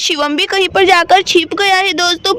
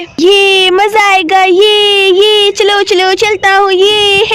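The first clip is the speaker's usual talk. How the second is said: put-on louder child-character cheer with stretched words; gleeful little Shivam; 6 dB louder, much slower than usual. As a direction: cheer like a mischievous boy, louder than the narration. Voices boy Shivam gleeful, louder. (शरारती बच्चे की खुशी भरी, तेज़ आवाज़)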